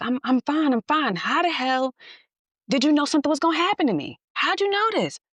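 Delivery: put-on high, fast, wound-up boyfriend; shaken and amazed. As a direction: play the stunned boyfriend, voice up high.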